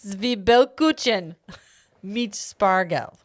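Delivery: slow, measured German; calm, slow foreign-word reading; careful German pronunciation. Shown as a demonstration. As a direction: sound out the German words evenly and deliberately. Repeats German calmly after aggressive take.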